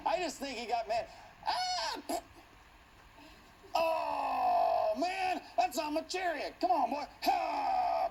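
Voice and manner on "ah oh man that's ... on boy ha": high, loud outraged cry; upset Jehu. Retold or imitated. Jehu shouting about blood on his chariot, high outraged. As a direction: yell like your chariot got dirty.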